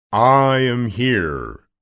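loud, long-drawn wizard boom; booming, stretched-out announcement; Oz's voice. Acted. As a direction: intone slowly like an unseen great wizard. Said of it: "said the voice".

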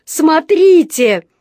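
high, loud, stretched-out shout; boy's urgent pointing yell; loud cry. A narrator's reading. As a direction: call everyone to look, loud and high. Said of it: "закричал Джонатан".

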